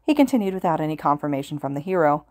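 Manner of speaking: ordinary delivery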